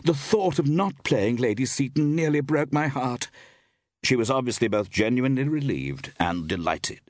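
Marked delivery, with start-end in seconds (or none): 0.0-3.3 high-pitched, gushing leading lady; theatrical actress. A narrator's reading. Iris voiced in narrated story.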